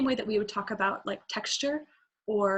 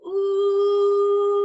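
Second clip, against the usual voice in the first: tight, pressed ooh, high and loud; pressed, strained vocal tone; 9 semitones higher, 8 dB louder than usual. Shown as a demonstration. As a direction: squeeze the sound with tension. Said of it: "So pressed would be".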